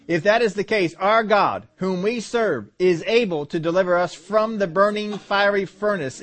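measured, steady Bible reading; scripture reading. Acted. Preacher reading Saul and David to the congregation. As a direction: read the verses to the church.

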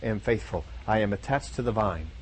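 low, soft, deliberate self-promise; quiet affirmation. Pretend. Preacher voicing a believer's mirror vow, low and soft. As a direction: speak it low and slow, word by word at the end. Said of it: "You look yourself in the mirror and said".

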